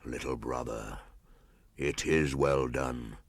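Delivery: low, soft, very slow panther; deep voice. Acted. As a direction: let it rumble low from the thicket. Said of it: "said a deep voice".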